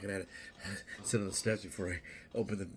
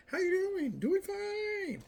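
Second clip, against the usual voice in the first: much higher and louder, fine drawn out slowly; sing-song fake politeness; mock cheery greeting; 7 dB louder, much slower than usual. Acting out passersby trading empty pleasantries, high sing-song. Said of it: "It's like".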